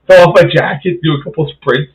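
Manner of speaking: ordinary talk, nothing marked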